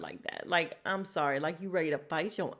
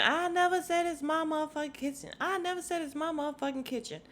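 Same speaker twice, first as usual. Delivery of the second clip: mock denial; 7 semitones higher, faster than usual. Quoting Mama Joyce's denial.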